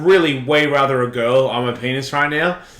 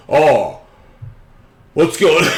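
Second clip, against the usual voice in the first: loud, hammed-up camp porn actor; 2 semitones higher, 5 dB louder than usual. Mocking an actor walking into a porn scene, hammed-up. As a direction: ham up a caricature entrance.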